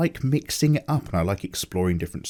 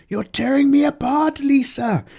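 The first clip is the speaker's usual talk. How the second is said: high, loud, strained movie meltdown; The Room impression; 5 dB louder than usual. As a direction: belt it like an overwrought cult-movie hero. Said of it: "far too early in the morning to do impressions of, uh, The Room".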